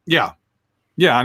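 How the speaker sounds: ordinary talk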